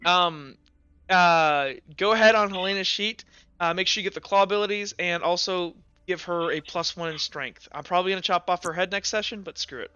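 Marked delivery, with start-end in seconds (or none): none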